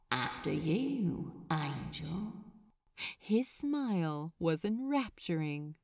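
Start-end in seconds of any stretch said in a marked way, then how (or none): 0.1-2.3 low, soft, teasing invitation; Crowley's soft invitation. A narrator's reading. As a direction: murmur it to him like a private invitation. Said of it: "as if to say".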